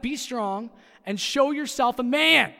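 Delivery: loud, slow coach bark; barking gym coach. Shown as a demonstration. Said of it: "Sound like a football coach again".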